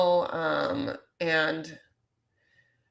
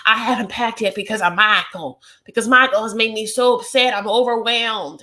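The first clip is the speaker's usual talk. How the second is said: mock upset Angela; 5 semitones higher, 7 dB louder than usual. Acted quote of upset Angela.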